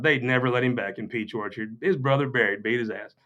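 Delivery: usual speaking voice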